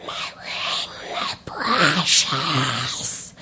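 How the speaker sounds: drawn-out, creepy creature rasp; hushed, low demonic croak, stretched out; Gollum. Retold or imitated. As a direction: imitate a creature hissing and croaking very slowly. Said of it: "it was Gollum, and he was saying".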